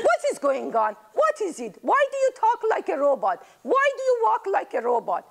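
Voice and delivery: high, fast, bewildered questions; baffled great-grandma. Acted. Great-grandma confused by robot-like grandchildren, high and baffled. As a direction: sound lost in a strange future.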